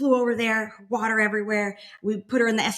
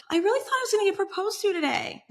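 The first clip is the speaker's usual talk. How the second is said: tearful; 13 semitones higher than usual. Retold or imitated. Tearful confession to mom.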